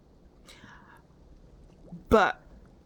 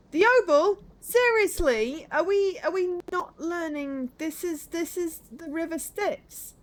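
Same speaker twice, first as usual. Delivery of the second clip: high, loud, drawn-out disbelief; incredulous; 10 semitones higher, 8 dB louder, slower than usual. Pretend. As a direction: exclaim it slowly, as if it's blindingly obvious. Exasperated at obvious myth reference.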